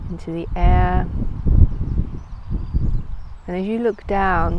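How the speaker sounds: calm, unhurried guided meditation voice. Acted. Yoga teacher leading listeners into a floating visualisation, calm meditative delivery. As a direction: guide them inward with long pauses between phrases.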